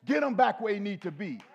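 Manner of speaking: loud commanding voice of God; God speaking. Retold or imitated. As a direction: boom like God calling down. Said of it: "God said".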